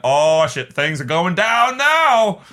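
loud, rising, drawn-out excitement; mock alarm. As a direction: build to a loud viewer's alarm. Voicing the viewer's alarmed thought.

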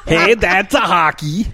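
high, slow hammed-up Canadian accent. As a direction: ham up a Canadian voice, drawn out. Mocking Canadians after accent bit.

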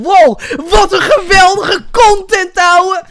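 high, loud gushing fan voice; mock excited fan. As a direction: gush like a starstruck fan. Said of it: "En dat niet zo was van".

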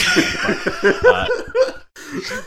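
high squeaky laugh through words; cracking up mid-sentence. Real, not acted.

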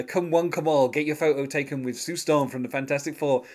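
ordinary talk, nothing marked

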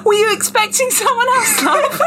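high, laughing British character voice; hammed-up British, cracking up as you go; British accent. Reader voicing the novel's smirking stranger, laughing British accent. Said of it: "I need to do British".

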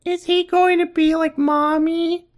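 put-on very high, slow child voice; squeaky put-on child asking a question; little girl Megan. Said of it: "I hear Megan ask".

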